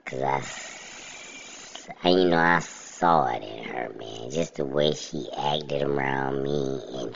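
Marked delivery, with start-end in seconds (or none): none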